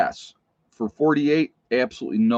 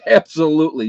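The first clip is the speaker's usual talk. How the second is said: laughing, louder and higher; 6 semitones higher, 9 dB louder than usual. Real, not acted. Answering about being attacked, laughing. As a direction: laugh it out as you repeat it.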